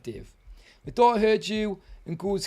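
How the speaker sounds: ordinary talk, nothing marked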